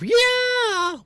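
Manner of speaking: a sudden shrill yelp; high startled yelp. Acted. Girl yelping at a sudden fright, very high. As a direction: squeak it out way up high. Voicing game character's startled cry.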